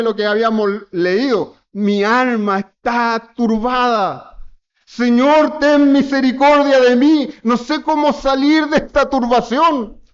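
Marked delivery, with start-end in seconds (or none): 1.7-10.0 higher, louder, pleading cry to the Lord; anguished prayer. Acted. Preacher voicing a troubled soul crying to God. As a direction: beg for mercy, voice lifted high.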